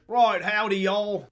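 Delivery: put-on a Brit overdoing a southern accent; mock southern accent. Mocking the actor's accent with a loud southern howdy. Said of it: "British people doing a southern accent".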